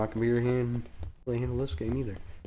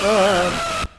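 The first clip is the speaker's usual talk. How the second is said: loud high yelp; startled yelp; 9 semitones higher, 9 dB louder than usual. Real, not acted. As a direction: yelp in sudden fright. Startled during a horror game.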